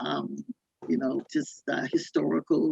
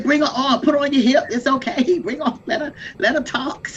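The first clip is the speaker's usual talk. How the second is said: urging, laughing; 8 semitones higher than usual. Retold or imitated. To a fellow panellist about a crying baby, laughing high urging. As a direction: egg the mother on to bring the baby. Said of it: "That's why I say".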